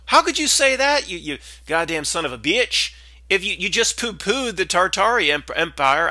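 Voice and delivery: high, loud, shrill-free mock outrage at Matt; mock angry viewer. An angry viewer screaming at Matt about Tartaria. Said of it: "people are screaming at this video".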